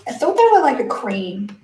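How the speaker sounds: ordinary talk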